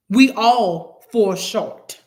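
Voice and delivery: slow, loud, each word drawn out; deliberate emphasis. Real, not acted. Preacher admitting everyone falls short, deliberately emphatic. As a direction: land every word slowly and loudly. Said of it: "I gotta say it like that".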